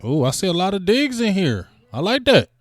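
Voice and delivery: high-pitched delighted reaction; pleasantly excited. Real, not acted. Streamer seeing lots of Diggs votes, high excited approval.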